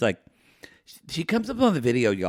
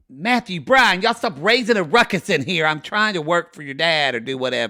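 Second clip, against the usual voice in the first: higher, raised mom voice; scolding mom; 4 semitones higher, 4 dB louder than usual. Retold or imitated. Mom coming in on noisy kids, scolding. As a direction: call out to the boys like a fed-up mother. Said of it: "My mom would come in and she'd say".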